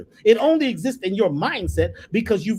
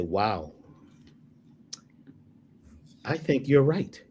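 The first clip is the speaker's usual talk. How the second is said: calm agreeing reply; 7 semitones lower, 3 dB quieter, slower than usual. Retold or imitated. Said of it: "I say".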